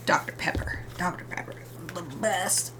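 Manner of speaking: unvoiced, hushed whisper; whispered aside. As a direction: whisper it like a confided favorite. Names favorite drink as a secret.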